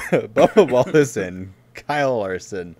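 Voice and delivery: dramatic announcement. Acted. Host unveiling the elephant in the room, slow and loud. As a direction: announce it like a big reveal.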